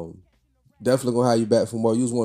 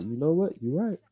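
put-on high, softer old lady voice; a quieter elderly woman agreeing; 6 semitones higher, 3 dB quieter than usual. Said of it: "start using my old lady voice".

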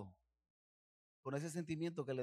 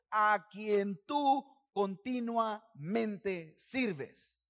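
loud, slow, higher emphatic reading; savoured emphasis; 4 semitones higher, 6 dB louder, much slower than usual. Shown as a demonstration. Preacher relishing his favourite verse, loud and slow. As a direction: lean into each word lovingly. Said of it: "me encanta esto".

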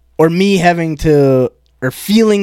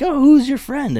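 friendly grandparents; 11 semitones higher than usual. Retold or imitated. Voicing his grandparents in story.

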